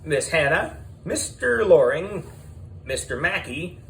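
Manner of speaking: high, booming host announcing the contestants; game show host. A narrator's reading. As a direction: call them down like a TV quiz host.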